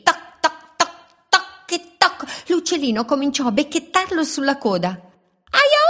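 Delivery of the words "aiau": drawn-out high loud yelp; a long squeal at the peck; yelp of pain. A narrator's reading. Robin pecks the wolf's tail, long high loud yelp.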